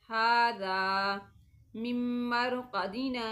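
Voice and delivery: tajweed sakta, each phrase recited twice; sakta recitation. Shown as a demonstration. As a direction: hold the sound, stop without breathing, then go on. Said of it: "फिर मैं पढ़ती हूँ".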